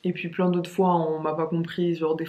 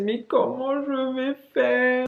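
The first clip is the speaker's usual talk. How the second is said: slow high lament; lost and helpless; helpless despair; 6 semitones higher, slower than usual. Retold or imitated. After the phone theft, a slow helpless lament. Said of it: "vraiment désemparée".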